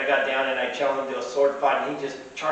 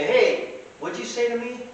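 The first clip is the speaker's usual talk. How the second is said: high-pitched imagined challenge; mock confrontation; 9 semitones higher than usual. Pirate challenging a passer-by, mock confrontation. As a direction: call him out, pitched up.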